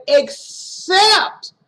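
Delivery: "except" pitched high, broken before a long second syllable; insistent, stretched-out "except"; pointed EXCEPT. Retold or imitated. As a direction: split "except" and push the "-cept" high. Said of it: "she continued where he cut it off".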